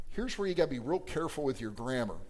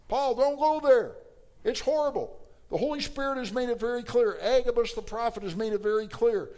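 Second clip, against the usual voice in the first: high, loud, urgent plea to a friend; urgent pleading; 8 semitones higher, 4 dB louder than usual. Acted. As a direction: beg him to stay away, voice raised high. Disciples pleading with Paul.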